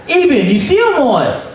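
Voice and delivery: high urgent call; high frantic question; calling across lot. Retold or imitated. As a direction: shout to a friend across the lot. Searching parking lot, calling friend.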